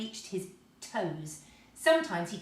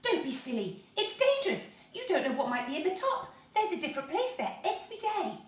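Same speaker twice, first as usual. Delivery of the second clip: alarmed warning; 11 semitones higher, 3 dB louder than usual. A narrator's reading. Brownie warning of danger.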